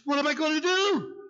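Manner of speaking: high, tearful falsetto imitation; imitating crying woman. Mayor imitating the crying young woman at his desk, high tearful voice. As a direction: squeak it out like a weeping young woman. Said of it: "That's the way she sounded".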